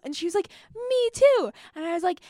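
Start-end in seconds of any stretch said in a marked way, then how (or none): 0.8-1.5 high, loud imitation of an amazed sister; excited amazement. Sister learns they shared a dream, imitated with high excitement. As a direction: squeal it in retold astonishment.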